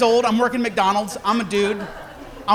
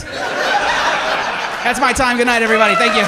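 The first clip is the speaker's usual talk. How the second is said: loud sign-off; 3 semitones higher, 8 dB louder than usual. Real, not acted. Closing set, loud sign-off.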